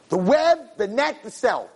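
WEB pitched high, then net and cell stepping down; pointed emphasis. Shown as a demonstration. Preacher has listeners hear the words web, net, cell, pointed emphasis. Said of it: "Listen to the words".

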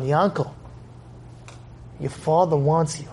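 soft, low delivery; gentle address. Acted. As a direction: say it softly to a found child. Friend gently finds the boy.

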